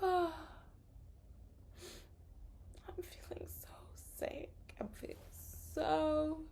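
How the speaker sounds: faint whispery sickly moan, hammed up; overacted sick person, barely breathing out the words; mock sick whine. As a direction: fake being too ill to speak. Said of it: "it feels like acting, but not good acting".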